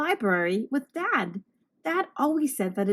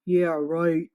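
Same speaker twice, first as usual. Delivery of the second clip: a lower monster voice, sneering; scoffing monster; 6 semitones lower than usual. Acted. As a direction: brush off the threat like a big beast.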